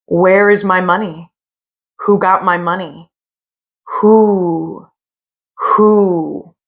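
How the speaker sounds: eerie moaning ghost voice rising on the whos; ghostly wail. Acted. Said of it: "It was a voice crying".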